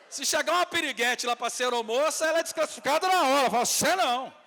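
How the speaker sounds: high, loud mock indignation; comic outburst. Real, not acted. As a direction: belt it out as a scandalized joke. Comic loud aside about airline hiring. (indignação cômica aos gritos)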